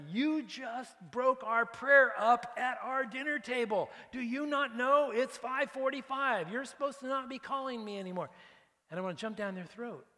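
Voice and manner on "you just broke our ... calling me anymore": mock angry rant. Preacher acting out yelling at a sales caller, angry. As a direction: bark it at the caller like you've lost patience.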